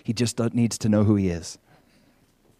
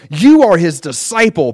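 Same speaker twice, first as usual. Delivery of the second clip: loud, raised accusation; cursing him; 5 semitones higher, 8 dB louder than usual. A narrator's reading. Pharisees to the healed man, cursing him. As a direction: throw it at him forcefully, pitched up. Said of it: "Then they cursed him and said".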